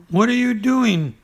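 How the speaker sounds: high, small piglet voice; Piglet's little voice. Acted. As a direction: squeak it like a tiny piglet. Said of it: "said Piglet".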